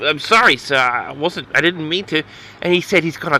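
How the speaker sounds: ordinary talk, nothing marked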